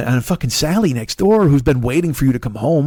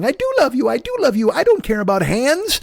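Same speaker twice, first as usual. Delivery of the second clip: put-on much higher, sing-song begging girlfriend; mock pleading girl; 9 semitones higher than usual. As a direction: gush it high like a desperate sweetheart. Mimicking the girl's pleading.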